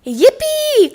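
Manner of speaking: high, loud whoop of joy; excited cry. A narrator's reading. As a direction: cheer like you just won. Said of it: "cried Froggy".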